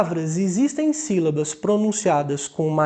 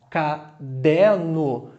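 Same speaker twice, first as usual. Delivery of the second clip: Caderno in three slow, lower beats; three separate syllables; 4 semitones lower, much slower than usual. Shown as a demonstration. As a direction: split Caderno as ca, der, no. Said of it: "Podem ter três sílabas".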